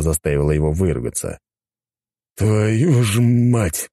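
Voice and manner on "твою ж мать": very slow, drawn-out curse; hissed curse. A narrator's reading. Inspector spots the gang tattoo, slow hissed curse. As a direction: stretch the oath out, pitched up. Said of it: "прошипел инспектор".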